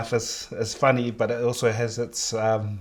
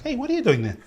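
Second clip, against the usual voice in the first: high-pitched imitation of a schoolkid; high, playful kid's challenge; challenging kid; 13 semitones higher, faster than usual. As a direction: mimic a kid questioning an outsider, voice way up. Acts out kids challenging her daughters.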